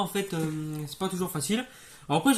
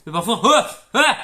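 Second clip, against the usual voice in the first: cri fort en riant; cri de tic; 3 semitones higher, 9 dB louder, slower than usual. Acted. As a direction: laisse échapper le tic. Tic de Tourette joué. (cri de tic de Tourette en riant)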